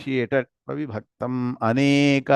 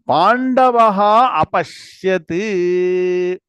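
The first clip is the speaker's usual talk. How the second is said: Pandavaha Apashyat drawn out, louder; slow recitation; 4 semitones higher, 5 dB louder, much slower than usual. Shown as a demonstration. Teacher leading the verse, reciting slowly and louder. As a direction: chant the words out slowly for the class.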